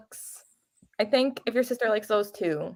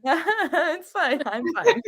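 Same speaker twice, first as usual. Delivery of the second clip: laughing it off; 7 semitones higher, much slower than usual. Real, not acted. Laughing off delayed book release.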